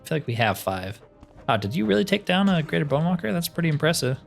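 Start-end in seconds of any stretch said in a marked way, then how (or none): none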